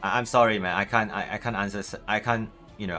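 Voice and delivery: normal talking voice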